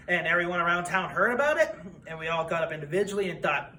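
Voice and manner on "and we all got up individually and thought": ordinary talk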